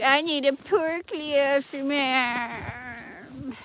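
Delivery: slow, high whine of a stuck dog; voicing the dog. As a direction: plead slowly in a put-on dog voice. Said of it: "He's like".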